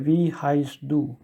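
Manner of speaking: slow, clear Wie heißt du, stress on Wie; slow demo. Shown as a demonstration. Teacher slowly models the informal question. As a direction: ask it slowly for learners. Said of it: "When you say".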